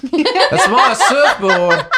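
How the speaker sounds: high, loud, slow mock Aussie bloke; laughing mock Australian accent; hammed-up Australian. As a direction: drag it out like an outraged Aussie. Joins the Aussie impression.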